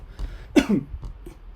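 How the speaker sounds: real hacking cough; a sudden choking cough; coughing fit. Real, not acted. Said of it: "coughing and choking".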